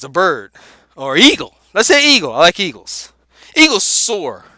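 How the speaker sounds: ordinary talk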